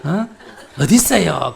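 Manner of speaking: loud, laughing curiosity; a loud laughing demand to know where he is; laughing eager question. Shown as a demonstration. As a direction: ask eagerly through laughter. Acting son's eager question, laughing.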